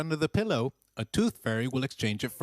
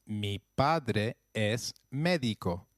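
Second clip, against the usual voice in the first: slow model phrase; 2 semitones lower, slower than usual. Shown as a demonstration. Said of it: "Try that, please".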